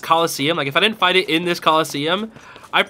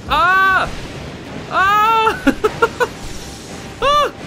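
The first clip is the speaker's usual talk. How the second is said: high panicked screams with a nervous laugh; scared screams; 14 semitones higher, much slower than usual. Real, not acted. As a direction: scream while dodging the beams. Dodging attacks in fear.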